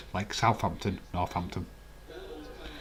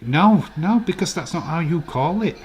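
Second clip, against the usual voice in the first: exasperated protest; 6 semitones higher, 4 dB louder than usual. Real, not acted. Heated correction.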